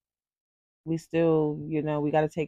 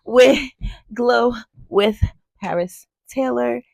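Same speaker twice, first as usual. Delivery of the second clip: host sign-off; 8 semitones higher, 9 dB louder than usual. Acted. Show sign-off outro.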